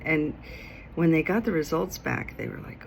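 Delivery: ordinary talk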